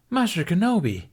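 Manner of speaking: high, eager call across the room; a student spotting his teacher, calling out gladly; excited call. A narrator's reading. Padawan calls out to Ben.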